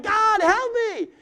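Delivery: high, loud, slow cry for help; yelling plea. Acted. Desperate believer yelling to God for help. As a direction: throw it up to heaven at full voice. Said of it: "you're just yelling".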